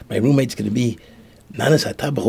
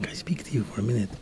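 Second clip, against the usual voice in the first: retold choked-up plea, softer and quicker; 9 dB quieter, faster than usual. Rabbi asks the butcher for a minute, choked up. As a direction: ask it softly, voice half choked away. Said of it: "As he's all choked up".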